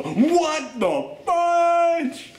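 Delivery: very high, hugely stretched exclamation; mock shocked outburst. Viewer reacting as slow motion snaps to speed, high shock. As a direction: blurt it high and hold the last word.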